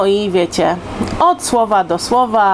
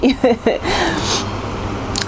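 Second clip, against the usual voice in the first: soft breathy laugh; 3 semitones higher, 4 dB quieter than usual. Real, not acted. Quiet laugh after cheeky remark.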